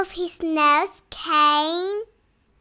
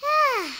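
long high sigh of comfort; contented sigh; 7 semitones higher, 3 dB quieter than usual. Acted. Winnie sighing happily as she settles in bed.

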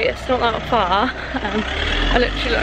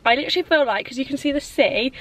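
ordinary talk

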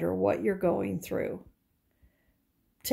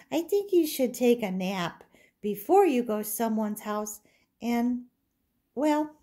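put-on little girl doll; 6 semitones higher than usual.

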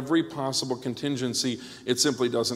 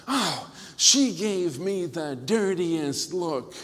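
slow, higher, wounded mock voice; offended lady; 4 semitones higher, much slower than usual. Lady complaining to her pastor, hammed-up slow indignation. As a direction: drag out the grievance, imitating her.

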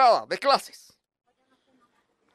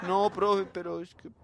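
meek student; 7 semitones lower, 10 dB quieter than usual. Retold or imitated. Student excuses himself.

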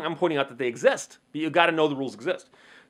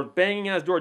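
normal talking voice

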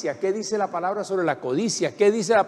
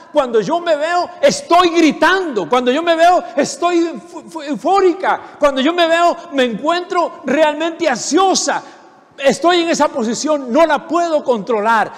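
high, loud, anxious cry; fervent shouting; 8 semitones higher, 7 dB louder than usual. Acted. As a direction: shout out your own helplessness. Preacher acting anxious believer.

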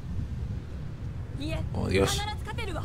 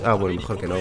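mock backing-off; 3 semitones lower, 4 dB quieter than usual. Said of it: "te metes ahí en medio y dices".

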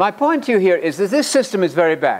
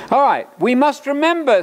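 high-pitched, mildly raised call; 10 semitones higher, 3 dB louder than usual. Real, not acted. Preacher raising voice for a listener not getting it, mild yell. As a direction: pitch it up to reach the back. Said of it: "got to yell a bit louder".

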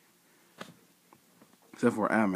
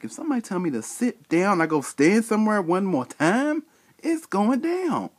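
rising anger; 9 semitones higher than usual. Retold or imitated. Ranting about being told to wait.

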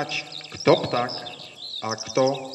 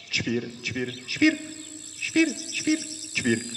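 sparrow chirp. Acted. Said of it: "Na to wróbel zaterlikał".

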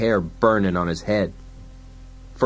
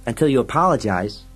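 higher, insistent demand; stubborn sidekick; 4 semitones higher than usual. Acted. Fergie demands Dredd apologize, high and stubborn. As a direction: dig in with a raised pitch.

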